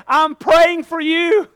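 slow, tender, earnest assurance; gentle encouragement. Retold or imitated. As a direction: lean in and say it kindly. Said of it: "he would say".

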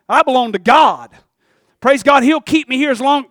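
loud, rising pulpit preaching; fervent preacher exhorting the crowd; preaching at full force. Acted. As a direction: drive it home to the congregation.

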